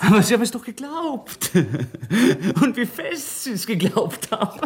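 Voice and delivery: laughing through the words, pitch well up; taunting the inspector with a slow, high laugh; mocking laughter. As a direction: gloat and laugh at him as you speak.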